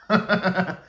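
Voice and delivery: short real laugh; amused chuckle. Real, not acted. Narrator amused by the pig that looks like Trump, amused chuckle. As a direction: chuckle briefly at your own joke.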